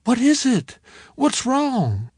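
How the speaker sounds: high, anxious questions; worried. Acted. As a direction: press him, growing alarmed. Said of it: "she sounded worried as well as concerned".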